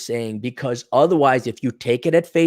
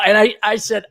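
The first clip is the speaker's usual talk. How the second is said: ordinary delivery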